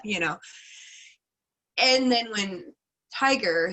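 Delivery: usual speaking voice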